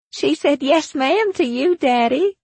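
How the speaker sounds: put-on very high, amused child's voice; giggling little girl. A narrator's reading. Little Sally teasing Daddy about the answer, giggly. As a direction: pipe up high, tickled by the mistake. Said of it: "Sally giggled".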